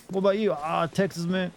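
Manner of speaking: light mock Southern twang; Southern accent. Pretend co-op partner replying in a Southern accent. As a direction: answer as a Texan in a Southern accent. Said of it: "You got that accent down?".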